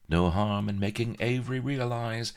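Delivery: high-starting spite, dropping at the end; mildly catty inner voice; spiteful thought. A narrator's reading. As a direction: think it aloud with a touch of spite. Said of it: "she thought spitefully".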